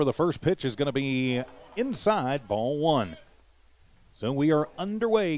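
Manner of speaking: lifted, punchy baseball radio call; live play-by-play. Real, not acted. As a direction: call the pitches live, punching each key phrase. Calls the game live.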